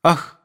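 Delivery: high anguished "ah"; anguished sigh. A narrator's reading. Nadenka, torn before answering, sighs in anguish. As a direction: let out a pained sigh. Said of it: "с тоской".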